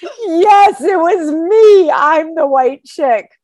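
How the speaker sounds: loud, high, drawn-out mock wail; hammed-up wail of shame; mortified confession. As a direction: own up in exaggerated embarrassment, slowly. Mock confession, head on counter.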